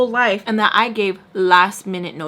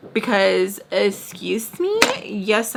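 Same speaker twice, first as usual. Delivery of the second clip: normal talking voice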